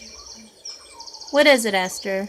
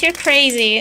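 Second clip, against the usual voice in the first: normal talking voice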